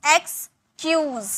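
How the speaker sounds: "एक्सक्यूज" slow, pause after ex; split syllables. Shown as a demonstration. Teacher having students remember "एक्सक्यूज", slowly broken in two. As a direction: say ex, then kyooz for "एक्सक्यूज". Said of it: "पहले आप इसे ई एक्स".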